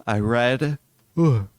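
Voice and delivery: words through a yawn. Real, not acted. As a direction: yawn while talking. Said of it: "yawning a lot right now".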